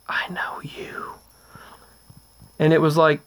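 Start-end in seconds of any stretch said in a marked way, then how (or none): 0.1-1.1 slow voiceless whisper from behind; eerie whisper. Retold or imitated. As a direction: breathe it slowly into someone's ear. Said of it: "A voice came up behind me and said".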